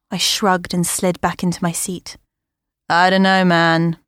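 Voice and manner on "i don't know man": deadpan serious delivery. Acted. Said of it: "in her most serious voice".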